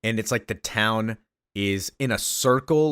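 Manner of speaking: ordinary talk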